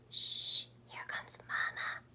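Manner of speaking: urgent whisper; hissed warning. A narrator's reading. Baby bird Pip warning the others, hissed whisper. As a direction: hiss it under your breath. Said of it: "hissed Pip".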